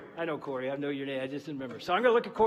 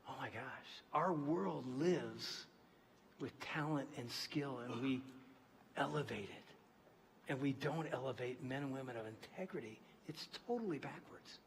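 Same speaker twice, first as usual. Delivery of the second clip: slow, soft intense sermon; hushed earnest preaching; 3 semitones lower, 10 dB quieter, slower than usual. Acted. Preacher to congregation, hushed conviction. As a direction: pull them in with quiet conviction.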